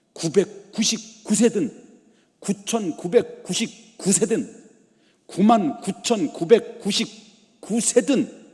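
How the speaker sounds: emphatic escalation. Real, not acted. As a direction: hammer out every number slowly and loudly. Escalating list of ages in preaching. (또박또박 힘주어 외치듯)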